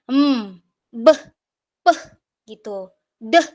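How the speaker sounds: short separate sounds, hmm, beh, peh, deh; bare consonant sounds, spaced apart; phonic letter sounds. Shown as a demonstration. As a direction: hum hmm, then pop beh, peh, deh with a schwa. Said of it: "Dalam pengucapan huruf juga diajarkan".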